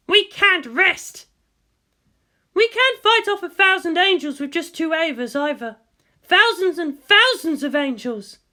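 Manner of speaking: lightly voiced determined commander, urgent; a grim officer pushing through pain; Misato, tense. Narrator voicing the wounded commander to the leaders, urgent.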